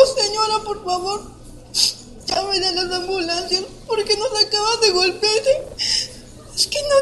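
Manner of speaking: begging through tears; tearful pleading. Real, not acted. Survivor retelling how she begged for an ambulance, tearful.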